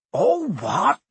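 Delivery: loud, outraged echo; thundering disbelief. A narrator's reading. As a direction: thunder it back, high and incredulous. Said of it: "Hagrid thundered".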